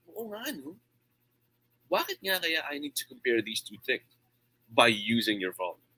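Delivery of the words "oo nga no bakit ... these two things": audience realization. Retold or imitated. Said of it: "give the audience a realization".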